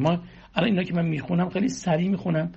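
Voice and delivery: ordinary talk, nothing marked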